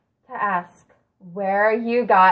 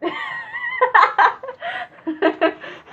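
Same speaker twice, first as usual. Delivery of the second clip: burst of laughter. Real, not acted. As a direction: break up laughing. Host breaks into laughter.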